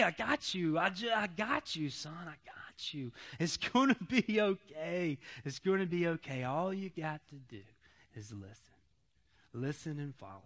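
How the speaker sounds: soft, low, reassuring father to son; tender reassuring voice. Retold or imitated. God reassuring a broken son, softly and tenderly. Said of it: "I could hear him just say".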